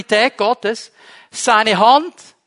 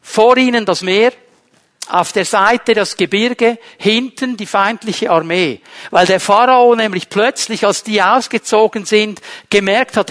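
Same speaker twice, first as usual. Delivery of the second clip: ordinary talk, nothing marked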